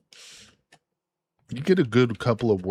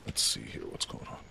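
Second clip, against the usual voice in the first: hushed aside while checking; muttered under breath; 6 semitones lower, 15 dB quieter than usual. Real, not acted. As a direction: whisper it to yourself, low. Low muttered aside to self.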